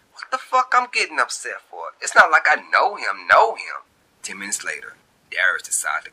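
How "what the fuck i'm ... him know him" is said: annoyed at herself. A narrator's reading. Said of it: "Shantae say aloud".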